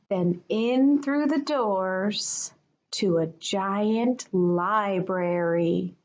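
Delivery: plain reading voice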